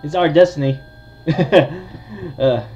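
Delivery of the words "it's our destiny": ordinary talk, nothing marked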